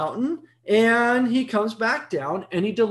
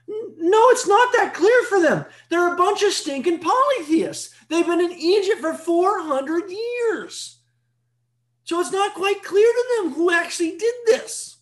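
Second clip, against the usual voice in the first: heated, raised preaching; 10 semitones higher than usual. Real, not acted. Exclaiming about polytheists.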